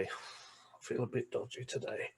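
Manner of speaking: weak unvoiced hungover mutter; hungover whisper. Hungover Yorkshire speaker to friend, feeble whisper. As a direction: croak it out like the morning after. Hungover feeling a bit dodgy.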